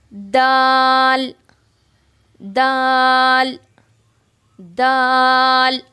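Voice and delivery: दाल stretched very slow; drawn-out letter. Shown as a demonstration. Teacher stretching daal slowly for students. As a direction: hold दाल long and loud. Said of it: "ये है".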